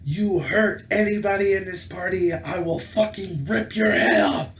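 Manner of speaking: high, furious threat yelled at a player; raging scream across the table, slow on the threat; retold scream. Player threatens another player at the table, in a furious high scream. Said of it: "I screamed".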